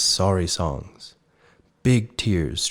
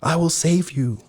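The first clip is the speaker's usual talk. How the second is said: eager cry; 10 semitones higher than usual. A narrator's reading. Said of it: "cried Snow Bear".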